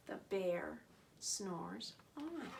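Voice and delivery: soft, slow "snores on"; hushed slow refrain. A narrator's reading. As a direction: let "on" fall away sleepily. Refrain, sleeping bear.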